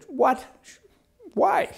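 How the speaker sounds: sharply high-pitched, disbelieving what and why; incredulous high squeak at the teller; shocked what. Retold or imitated. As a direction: yelp the questions in outrage. Bank refuses cash deposit.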